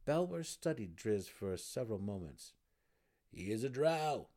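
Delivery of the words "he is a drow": resonant, slightly perturbed huff. A narrator's reading. Burrow warden eyeing the prisoner, perturbed huff. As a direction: huff it out loudly, a bit perturbed. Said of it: "huffed in his resonant voice, sounding a bit perturbed".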